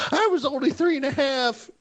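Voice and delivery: put-on high-pitched joking kid voice; squeaky mock toddler; mock little voice. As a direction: pipe it up high for a joke. Joking he was a child prodigy.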